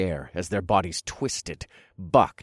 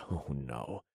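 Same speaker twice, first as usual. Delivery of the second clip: menacing hush; 5 semitones lower, 12 dB quieter than usual. Acted. Narrator taunts doomed soldiers.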